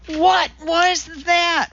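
put-on high, slow, breathless voice of an old man in shock; gasped. A narrator's reading. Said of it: "Walter gasped".

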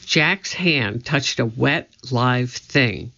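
usual speaking voice

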